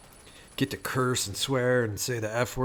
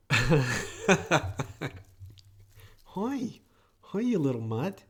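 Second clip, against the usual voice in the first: cooing at the cat; 4 semitones higher, much slower than usual. Real, not acted. Greets cat on the table.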